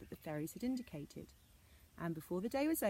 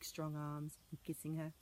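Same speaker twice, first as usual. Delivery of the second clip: ordinary delivery, nothing marked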